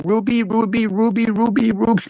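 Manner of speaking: high, rapid-fire repeated calling; [name]. Acted. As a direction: chant the name high without a break. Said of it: "when [name] was saying".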